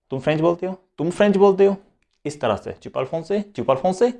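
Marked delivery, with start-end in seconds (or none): 0.1-1.8 बोलते हो said rising, higher in pitch; questioning tune. Shown as a demonstration. Teacher demonstrating a Hindi question tune. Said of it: "लगे कि आप उससे सवाल पूछ रहे हो". 2.7-4.1 higher pitch, français rising; questioning tune. Shown as a demonstration. Teacher showing question by intonation. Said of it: "लगे कि आप उससे सवाल पूछ रहे हो".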